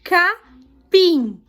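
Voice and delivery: capim in two separate beats, PIM strong; teacher dictating "capim" with stress on the last syllable; stressed syllable demo. Shown as a demonstration. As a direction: say "capim" split up, punching "pim". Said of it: "pinte a sílaba tônica".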